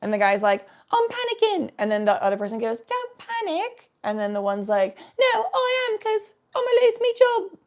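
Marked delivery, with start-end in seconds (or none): 0.9-1.7 put-on high-pitched British or Australian accent; mock-panicked British audio clip voice; British accent impression. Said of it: "the British version". 2.8-3.9 put-on slow, high British or Australian accent; put-on British voice, stretched out; British accent impression. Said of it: "the British version". 5.2-7.6 put-on very high, wailing British accent; mock-panicked whine in a broad British accent; British accent impression. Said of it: "the British version".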